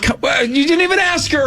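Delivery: incredulous high protest; indignant retort. Retold or imitated. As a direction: squeal it in disbelief. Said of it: "It's like".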